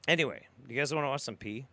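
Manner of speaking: usual speaking voice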